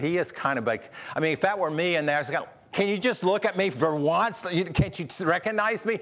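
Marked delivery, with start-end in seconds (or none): 2.7-6.0 higher-pitched exasperated plea; mock exasperation. Preacher imagining himself as unrecognized Jesus, mock exasperation. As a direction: plead in mock disbelief at not being noticed. Acting out his own frustration.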